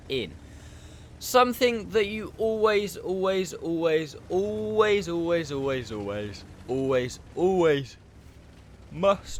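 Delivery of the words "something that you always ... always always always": "always" repeated, the first high, then stepping down; emphatic repetition. Real, not acted. Telling viewers what they must always do, emphatic "always". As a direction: hammer "always" over and over, stretching some.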